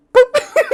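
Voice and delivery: high, loud boop sound effect; imitated shot. Narrator imitating his own airsoft shot, high boop. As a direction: pop it out like a toy gun. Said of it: "I just went".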